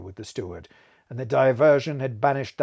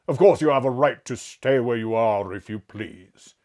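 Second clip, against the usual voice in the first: petulant; 6 dB louder than usual. A narrator's reading. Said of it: "rather petulantly".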